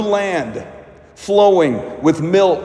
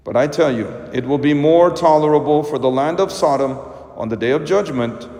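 solemn, lower liturgical proclamation; Gospel reading; 4 semitones lower than usual. Acted. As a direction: read it like a priest at the altar.

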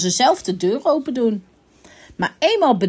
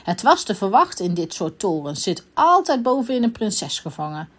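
ordinary delivery, nothing marked

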